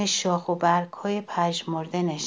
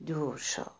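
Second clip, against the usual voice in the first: ordinary delivery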